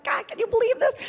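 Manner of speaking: high-pitched giddy amazement; excited disbelief. Retold or imitated. Daughter showing her mom the plane, giddy disbelief. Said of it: "it's still exciting".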